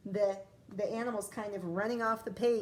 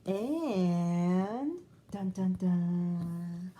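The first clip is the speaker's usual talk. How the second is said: dramatic dun dun dun; 3 semitones lower, 3 dB louder, much slower than usual. Acted. Dramatic suspense sound.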